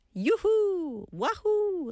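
high gleeful whoop; yahoo cheer. Shown as a demonstration. Host demos the yaru cheer, gleeful whoop. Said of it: "like this".

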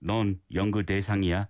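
"넌 연구 대상이야" on one level; monotone. Shown as a demonstration. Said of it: "a monotone".

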